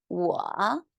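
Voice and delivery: careful third-tone "我" for repeating; demonstrated Mandarin tone. Shown as a demonstration. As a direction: dip and lift through "我". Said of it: "say that one more time".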